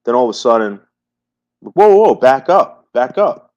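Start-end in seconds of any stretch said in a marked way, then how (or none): 1.6-3.5 loud, high alarmed warning, imitated; retold screaming. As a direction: re-enact someone yelling to back off. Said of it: "this guy fucking screaming at Bergs".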